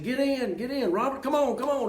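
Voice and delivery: urgent calling. Retold or imitated. Yelling to Robert to jump in the truck, urgent.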